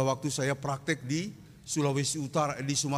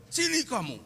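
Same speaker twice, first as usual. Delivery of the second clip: stern father; 11 semitones higher than usual. Retold or imitated. Father summons son sternly.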